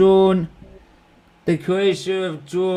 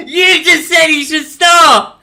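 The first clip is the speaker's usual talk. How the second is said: high, loud, slow laughing shout; laughing protest; 13 semitones higher, 8 dB louder, slower than usual. Real, not acted. Catching his mate contradicting himself, laughing shout. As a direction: protest loudly through real laughter.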